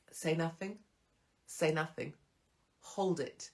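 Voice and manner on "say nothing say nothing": usual speaking voice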